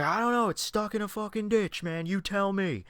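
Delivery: high put-on voice of the pissed driver; imitating the annoyed driver. Stranded driver snapping at the narrator, mock annoyed.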